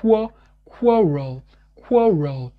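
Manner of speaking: "quarrel" slow, rounded "wa" start; English quarrel. Shown as a demonstration. Teacher modelling quarrel for learners, slow. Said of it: "les lèvres qui font le même mouvement".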